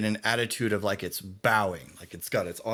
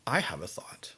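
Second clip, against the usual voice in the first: hushed near-whisper; conspiratorial; 6 dB quieter than usual. Real, not acted. Game master has a thought, conspiratorial hush. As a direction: drop to a sly, breath-only murmur.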